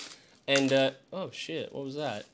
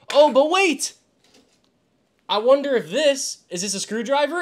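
much higher, louder gasp of surprise; sudden discovery; 9 semitones higher, 7 dB louder than usual. Real, not acted. Streamer spotting a screwdriver with his glasses, surprised. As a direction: catch yourself noticing something amazing.

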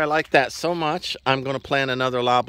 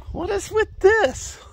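high, slow, drawn-out mock dismay; slower than usual. Real, not acted. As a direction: pretend to be shocked at the mane. Mock shock at horse's mane.

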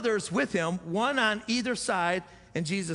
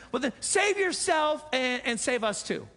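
taunting thief; 7 semitones higher, 3 dB louder, slower than usual. Retold or imitated. Thief taunts Jesus to save himself.

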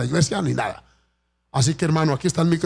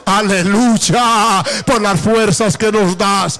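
raised, ringing call to praise; fervent preaching; 5 semitones higher, 4 dB louder, slower than usual. Acted. Pastor urging the church to stand and give thanks, fervent preaching. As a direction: lift the congregation with rising fervour.

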